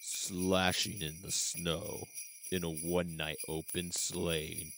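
low, slow, soft trailer narrator; movie voice. Acted. Co-host reads a slasher synopsis to set the mood, movie voice. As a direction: read it like a trailer, drawn out and deep. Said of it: "I wasn't prepared for movie voice [name]".

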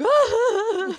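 high laughing cry, loud; laughing wail. Retold or imitated. Retelling crying in the back room, high wail. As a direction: wail through laughter like sobbing. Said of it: "I'm like".

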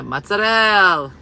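mozzarella as mutza-DELL, final a dropped; loud, drawn-out mozzarella ending on -ell; Italian-American pronunciation. Shown as a demonstration. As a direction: belt out mozzarella, slow, with a long DELL. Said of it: "you spelled it right".

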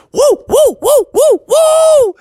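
very high, loud woo-hoos; joyful whoops. Acted. Mom celebrating the family trip, shrill high whooping. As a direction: whoop like a thrilled cheerleader.

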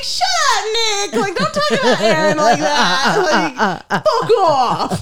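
high, loud mock scolding; indignant telling-off through laughter; protective outburst. Scolding Nick in retold outburst.

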